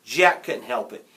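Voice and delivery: ordinary delivery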